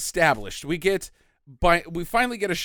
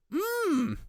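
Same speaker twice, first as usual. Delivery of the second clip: mock savoring; 9 semitones higher than usual. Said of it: "she's like".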